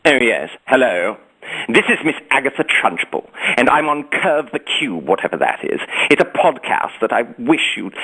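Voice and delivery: haughty British headmistress character; Miss Trunchbull. Acted. Actor greeting podcast listeners as the headmistress, imperious British voice. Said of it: "in your best Miss Trunchbull".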